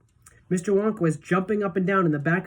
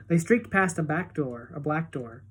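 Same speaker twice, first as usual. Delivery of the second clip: ordinary delivery, nothing marked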